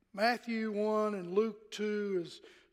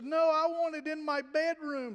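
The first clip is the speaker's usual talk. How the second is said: put-on upset little girl; 6 semitones higher than usual. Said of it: "she started crying a little bit".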